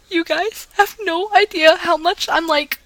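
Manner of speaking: high, choked-up voice; about to cry. Real, not acted. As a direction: hold back tears, barely. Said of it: "I'm getting ready to cry".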